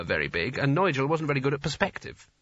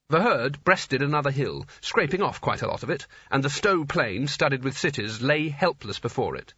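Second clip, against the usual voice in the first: ordinary delivery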